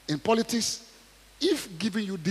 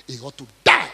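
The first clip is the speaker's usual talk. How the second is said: loud demand; 3 semitones lower, 11 dB louder than usual. Acted.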